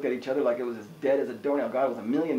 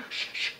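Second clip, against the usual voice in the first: soft "shh, shh" of an absorbed moviegoer; shushing; 5 dB quieter than usual. Retold or imitated. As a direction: shush someone twice, quietly. Shushing during the film's climax.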